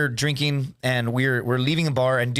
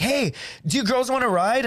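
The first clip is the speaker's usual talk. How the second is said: higher-pitched retold pick-up call; mock calling out; 8 semitones higher than usual. Retelling calling to girls from the car window, mild calling out.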